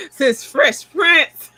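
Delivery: slow, laughing tease, voice high. Real, not acted. Teasing her friend about [name]'s past, laughing slowly. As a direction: drawl it out while laughing.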